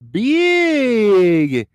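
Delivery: slow, high, loud donation shout-out; big drawn-out announcer-like hype; hyped announcement. Real, not acted. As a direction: stretch "big" and belt out the news.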